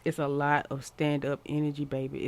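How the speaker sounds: normal talking voice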